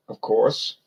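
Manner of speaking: ordinary delivery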